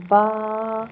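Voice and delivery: با loud with a long open "aa"; Arabic letter name. Shown as a demonstration. Teacher models the second letter, long loud Arabic با. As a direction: sound out با firmly, holding the aa. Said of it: "اب ہمیں عربی لہجے میں پڑھنا ہے".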